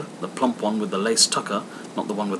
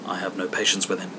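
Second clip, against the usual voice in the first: impatient remark; 5 semitones lower, 6 dB quieter, faster than usual. A narrator's reading. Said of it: "observed Scrooge's niece".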